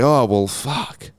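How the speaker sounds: slow, resigned mock annoyance; mock pissed off. Comedian playing shopper spotting an ibis, slow mock annoyance. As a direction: draw it out as a fed-up customer. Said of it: "he's pissed off".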